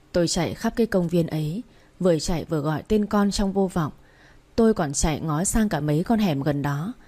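usual speaking voice

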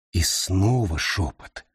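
plain reading voice

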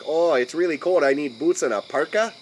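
exaggerated Midwestern accent demo. Shown as a demonstration. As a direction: do a quirky, almost-Canadian Midwestern voice. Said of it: "then the Midwestern thing".

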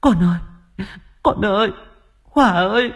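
high, anguished calling out; desperate calling. Acted. Mother searching the pond, desperate calling. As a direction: call into the dark for the child. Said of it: "âm thanh kêu gọi thảm thiết của bà".

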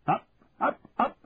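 high barked wake-up call; shouted wake-up. Acted. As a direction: rouse the sleeping recruits. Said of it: "He slammed the door behind him and shouted".

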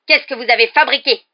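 high, very loud accusation; furious shout. A narrator's reading. Rogue demanding what Potter has done, shouting. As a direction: yell the question in rage. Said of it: "cria-t-il".

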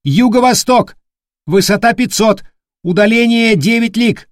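high, loud lookout report; shouting. Acted. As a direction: call the numbers out loud. Lookout calling out sightings.

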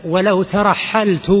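ترحلت said slowly as tarahhaltu, ending in u; slow dictation of ترحلت with a clear u ending; damma on ترحلت. Shown as a demonstration. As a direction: correct the reader, drawing out the final -tu of ترحلت. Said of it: "ضع على التضمه".

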